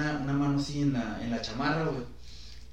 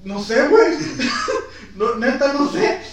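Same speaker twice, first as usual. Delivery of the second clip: nervous laughing; 8 semitones higher, 9 dB louder, slower than usual. Retold or imitated. Teller in shock, laughing.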